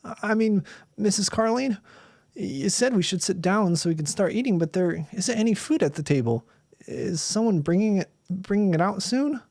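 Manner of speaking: put-on a frightened kid stammering; nervous boy Bill. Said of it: "started Bill Davis".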